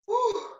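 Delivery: a high, loud, winded exhale; breathless whew. Real, not acted. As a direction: blow out a high whew, out of breath after the workout. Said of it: "I'm out of breath".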